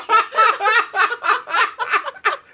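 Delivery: high laugh. Real, not acted. Laughing at Florida joke.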